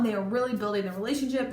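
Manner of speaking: ordinary delivery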